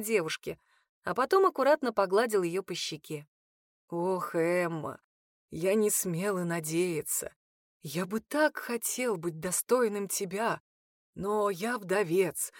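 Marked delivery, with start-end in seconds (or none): 3.9-12.5 tender confession. A narrator's reading.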